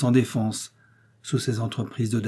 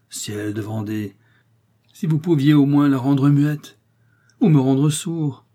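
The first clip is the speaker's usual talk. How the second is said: mock exasperation; 4 semitones higher, 5 dB louder, slower than usual. A narrator's reading. Husband exasperated by wife.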